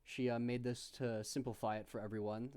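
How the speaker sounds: ordinary talk, nothing marked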